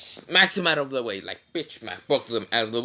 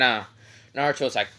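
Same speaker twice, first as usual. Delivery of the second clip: ordinary delivery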